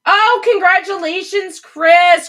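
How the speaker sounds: loud, high congratulations; bursting with delight; excited. Real, not acted. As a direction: cheer for a friend's good news.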